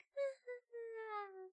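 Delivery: very high, soft whimpering sob; high, quiet fake crying; mock whimpering cry. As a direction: imitate bursting into tears. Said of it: "someone yells at me and I'm like".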